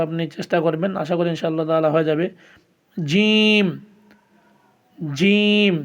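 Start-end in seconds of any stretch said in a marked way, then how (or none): 3.0-5.7 জিম said hard, pitched up and drawn out; forceful Arabic jim. Shown as a demonstration. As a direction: press জিম out firmly. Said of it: "এভাবে শক্ত করে পড়বেন".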